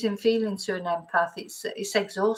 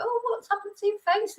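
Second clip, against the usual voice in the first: very high mock dad voice; high, blunt parental remark, retold; brutally honest dad; 12 semitones higher than usual. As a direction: imitate tactless dad in a much higher pitch. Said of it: "my dad was so brutally honest".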